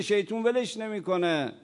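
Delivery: usual speaking voice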